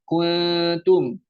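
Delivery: "Kuntum" with long hidden N; plain blurred ikhfa. Shown as a demonstration. Teacher showing nakis, "Kuntum" with lingering blurred N. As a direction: hold the N of "Kuntum" blurred, without G. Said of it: "samar aja biasa".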